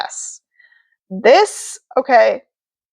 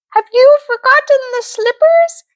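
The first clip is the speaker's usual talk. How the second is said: high, sweet fairy voice; sing-song good witch; Glenda the good witch; 13 semitones higher, 3 dB louder than usual. Acted. Glenda asks.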